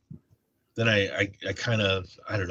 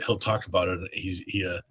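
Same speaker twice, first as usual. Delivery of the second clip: normal talking voice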